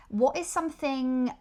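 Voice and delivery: ordinary talk, nothing marked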